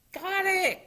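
very high, loud exclamation; triumphant cry. A narrator's reading. Froggy catches the ball in his cap, high cry. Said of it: "cried Froggy".

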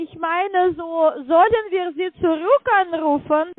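ordinary delivery

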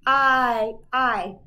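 loud, stretched "I" sound. Shown as a demonstration. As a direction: call out the long "I" clearly. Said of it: "Now our vowel sound".

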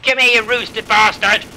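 heated, full-volume gamer threat; shouted threat. Real, not acted. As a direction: bellow it at an enemy player. Mock threat to an opponent.

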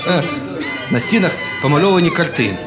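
ordinary delivery, nothing marked

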